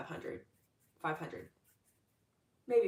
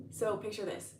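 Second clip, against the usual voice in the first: usual speaking voice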